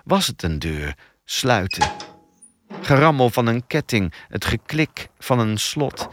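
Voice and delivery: ordinary delivery, nothing marked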